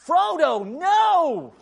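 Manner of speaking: high desperate yell; shouted plea to stop. Retold or imitated. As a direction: cry out after the departing boat. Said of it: "he yells out".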